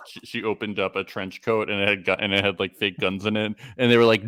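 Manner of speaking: ordinary delivery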